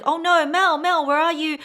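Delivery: panicked salesman. Retold or imitated. Sales associate searching for Mel, mock panic. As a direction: imitate the associate fretting for Mel.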